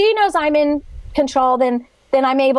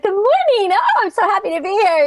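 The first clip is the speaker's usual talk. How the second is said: high, bubbly sing-song greeting; overly cheerful coworker; 8 semitones higher than usual. Retold or imitated. Imitating the sunny coworker who greets everyone Monday morning, high and bubbly. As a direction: chirp it like the relentlessly happy morning person. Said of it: "she comes in Monday morning and she's like".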